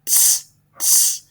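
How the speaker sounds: short unvoiced "T" puffs; letter T sound. Shown as a demonstration. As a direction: breathe out "T" twice, crisp. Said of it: "upper and lowercase".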